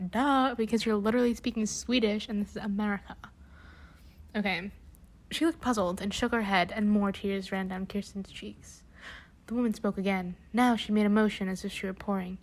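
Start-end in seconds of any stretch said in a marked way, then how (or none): none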